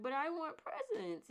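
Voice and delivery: put-on high, loud child's whine; a daughter's loud high complaint; whiny child. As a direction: voice the sad little girl wanting gifts. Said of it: "And she was like".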